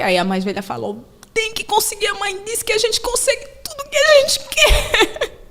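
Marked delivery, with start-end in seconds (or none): 1.3-5.4 put-on older daughter insisting. Older daughter voiced, laughing.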